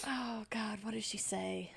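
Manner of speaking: whispered search for words; thinking aloud. Real, not acted. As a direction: whisper it to yourself, stumped. Player thinks aloud, stuck for words.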